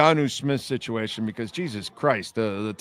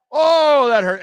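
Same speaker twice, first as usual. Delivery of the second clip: much higher and louder, reliving the sting in fun; mock pained yell; 12 semitones higher, 8 dB louder than usual. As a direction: yelp it like the paddle still hurts. Remembering the paddle's sting.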